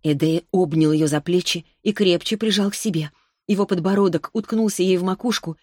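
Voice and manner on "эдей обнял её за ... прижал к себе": ordinary delivery, nothing marked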